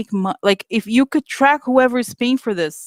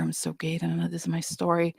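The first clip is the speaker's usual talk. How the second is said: mock mirror talk; 3 semitones lower, 10 dB quieter than usual. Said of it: "looking herself in the mirror".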